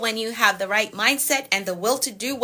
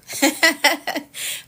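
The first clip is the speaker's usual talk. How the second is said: high chuckling laugh; real, amused burst of laughter; 6 semitones higher than usual. Real, not acted. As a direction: laugh freely in a quick run. Laughs after accent remark.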